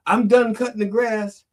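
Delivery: put-on little kid tells his mom the chore is done, high and eager; proud little kid. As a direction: report a finished chore like an eager child. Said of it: "Elijah's like".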